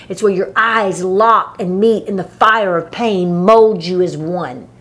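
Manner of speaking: ordinary talk, nothing marked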